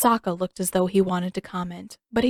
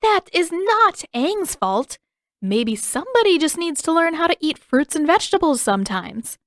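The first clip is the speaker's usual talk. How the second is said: scolding; 8 semitones higher, 4 dB louder than usual. Acted. Katara scolding.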